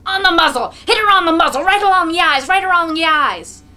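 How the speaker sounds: roaring, high and loud; drunken shout. A narrator's reading. Mokolka urging the crowd to beat the mare, roaring. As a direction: bellow it like a drunk brute whipping a horse. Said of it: "Mokolka shouts".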